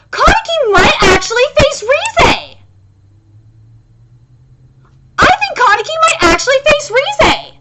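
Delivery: high, loud real outburst; agitated. Real, not acted. Fan realizing who Kaneki might face, agitated. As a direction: squeal the theory out, worked up. Agitated theory about the manga's ending.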